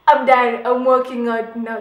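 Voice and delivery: retold angry I'm-out shout; fed-up outburst. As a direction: announce you're done and leaving, loudly. Fed up mid-piercing.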